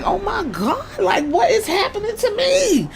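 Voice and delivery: high-pitched mock panic, half laughing; panicked manager. Paul panicking, mocked.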